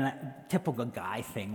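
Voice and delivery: usual speaking voice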